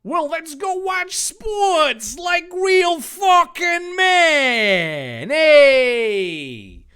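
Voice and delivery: very high, slow bro yell; slow bro shout. Acted. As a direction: hype the guys up, slow and loud. Dave voiced, rowdy.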